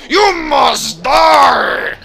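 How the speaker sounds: drawn-out, high, mock-furious shout; over-the-top roar; hammed-up death threat. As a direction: bellow a mock threat, stretching every word. Mock outburst at game character.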